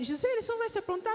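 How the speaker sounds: quick, high mock-husband voice, teasing; imitating husband. Husband hurrying his wife to get ready, mock voice high and quick. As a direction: toss it off high and fast like the impatient husband. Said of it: "ele falava assim".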